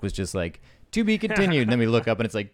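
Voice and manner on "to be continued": high, sing-song announcer line; mock trailer voice. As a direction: tease it like a cliffhanger. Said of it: "Katie, as a joke".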